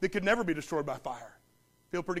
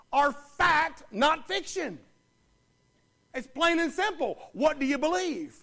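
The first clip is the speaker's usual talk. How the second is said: much higher and louder, driving the questions home; full-force preaching; 5 semitones higher, 5 dB louder than usual. Acted. Preacher demanding the congregation choose, fervent and loud. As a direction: challenge every listener in the pews.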